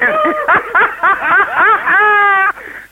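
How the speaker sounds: high giggling laugh; laughing at own joke. Real, not acted. Joke teller cracking up mid-joke, giggling laugh.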